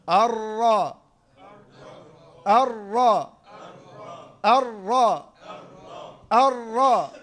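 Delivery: "الراء" with a brief, contained roll on the ر; controlled raa. Shown as a demonstration. Teacher modelling for students to repeat, controlled ر in "الراء". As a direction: give the ر of "الراء" just one or two beats. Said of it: "حركة أو حركتان. شوف".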